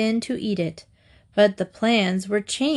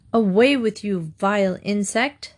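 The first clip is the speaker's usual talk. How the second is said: plain reading voice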